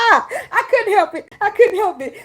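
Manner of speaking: high, loud, laughing apology; helpless laughter. Real, not acted. Excusing her own outburst, high laughing voice. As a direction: squeal it through laughter.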